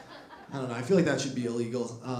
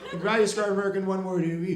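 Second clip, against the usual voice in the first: higher, fast build-up; Biden impression; 5 semitones higher, faster than usual. Biden about to name America's one word, quick build. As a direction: race toward the big word. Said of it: "he's like".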